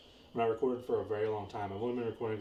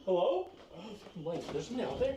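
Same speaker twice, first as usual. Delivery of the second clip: nervous calling out; 9 semitones higher than usual. Real, not acted. Calling out into the dark.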